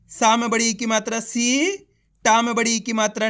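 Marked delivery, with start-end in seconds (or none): 1.2-1.8 सी with a long, rising ee; drawn-out vowel. Shown as a demonstration. Teacher shows learners how to read the long ee, drawn-out सी. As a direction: draw out the vowel of सी. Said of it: "खींच के पढ़ा जाता है".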